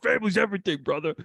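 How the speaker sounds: a hint of action-hero bravado; Dominic Toretto. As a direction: playfully imitate a movie street racer. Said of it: "Was this Dominic Toretto?".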